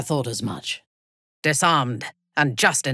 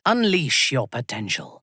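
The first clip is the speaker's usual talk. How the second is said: normal talking voice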